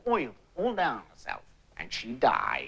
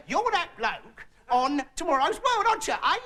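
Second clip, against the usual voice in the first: cabbie; 8 semitones higher than usual. Retold or imitated. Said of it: "No, he said".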